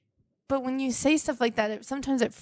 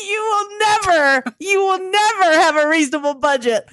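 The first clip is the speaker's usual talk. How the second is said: shouting; 7 semitones higher, 11 dB louder than usual. Acted. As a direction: yell it at your partner. Shouting at partner in skit.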